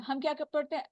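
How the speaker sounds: normal talking voice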